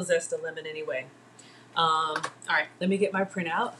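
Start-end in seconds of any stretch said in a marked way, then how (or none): none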